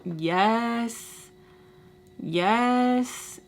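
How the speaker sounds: long, drawn-out reenacted answer; calling to the door. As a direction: stretch it out toward the knocking. Answering endless knocking.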